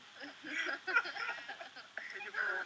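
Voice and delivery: long, high-pitched laugh; laughter. Real, not acted. Co-hosts teasing Ismaël about his microphone, long laughter.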